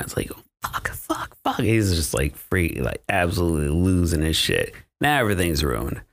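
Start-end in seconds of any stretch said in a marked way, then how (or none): 0.6-1.6 freaking out. Retold or imitated. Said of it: "Luke starts freaking out. He's crying".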